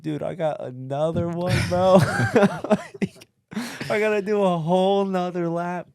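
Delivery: high, loud, strained whine of disbelief; hammed-up whining about another lap; mock despairing whine. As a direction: squeal it like you can't believe your bad luck.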